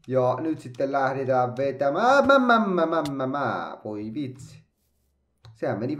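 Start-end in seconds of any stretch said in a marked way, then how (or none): 2.3-3.8 high, loud stammer of panic; panicked stammer. Real, not acted. Gamer fumbling a parkour jump, high panicked stammer. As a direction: sputter as the jump goes wrong.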